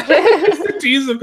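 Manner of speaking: laughing at the memory. Real, not acted. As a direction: laugh it out, slowed down. Laughing while explaining tease.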